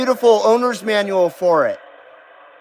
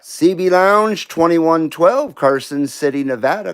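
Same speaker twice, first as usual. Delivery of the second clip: CB radio call; 4 semitones lower than usual. Real, not acted. Calling out on CB radio.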